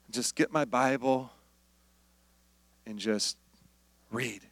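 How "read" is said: ordinary talk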